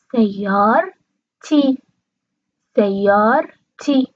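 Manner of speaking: سیارتی said slowly as sayyar-ti, r with no vowel; slow teacher's demo of سیارتی as sayyar-ti; silent vowel after r. Shown as a demonstration. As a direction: stretch سیارتی into sayyaar-ti, closing on the r.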